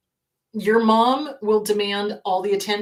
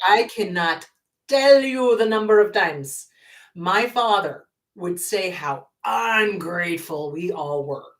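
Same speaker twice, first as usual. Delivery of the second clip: usual speaking voice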